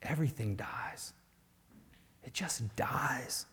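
soft whispered resignation, slowing; despairing whisper. Retold or imitated. Preacher voices the paralyzed thought that everything dies. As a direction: let the hope drain out.

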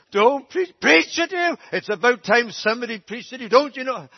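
loud and high, hammering preach; mother shouts back at her drenched son. Retold or imitated. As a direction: scold him like a preacher. Mother retorts while preaching.

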